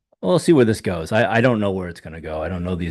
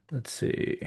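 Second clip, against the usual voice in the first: losing voice; 6 semitones higher, 8 dB quieter than usual. Real, not acted. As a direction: voice gone to a breathy whisper. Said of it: "I'm starting to lose my voice".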